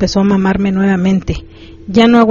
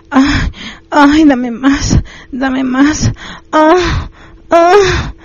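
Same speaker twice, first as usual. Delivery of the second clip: high acted moans, pleading for more; almost-screaming moans; 7 semitones higher, much slower than usual. A narrator's reading. Narrator voicing the woman's pleas, moaning almost at a scream. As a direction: moan high and urgent, short of a scream. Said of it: "gemía casi a gritos".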